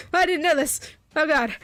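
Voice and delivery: amused, mildly exasperated outburst; laughing dismay. Real, not acted. Laughing at surprise British voice request.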